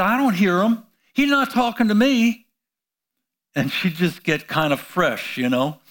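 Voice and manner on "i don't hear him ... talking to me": put-on higher, mouthy young girl talking back; sassy teen retort. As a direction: toss it off like a fresh teenager.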